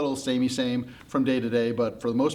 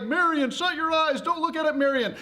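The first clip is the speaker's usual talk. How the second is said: high, frantic cry; panicked warning; 14 semitones higher than usual. Acted. One guy warning Marion not to look, frantically. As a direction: plead urgently with pitch way up. Man panics amid escaping problems.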